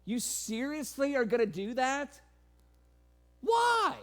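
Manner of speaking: ramp the scorn up to a yelled why; incredulous sarcasm. Retold or imitated. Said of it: "it's his way of saying".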